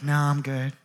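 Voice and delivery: low, soft, stubborn doubt; acted sceptic, muted and unmoved; quiet refusal. Bitten man refuses to believe the snake, quiet refusal.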